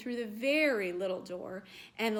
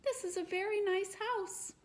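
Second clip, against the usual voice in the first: happy little ghost; 10 semitones higher than usual. Acted.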